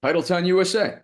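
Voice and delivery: high, loud hometown cheer; shouted boast for his hometown; proud shout. Real, not acted. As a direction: cheer it like a proud Boston fan. Asked to hype Boston as sports town.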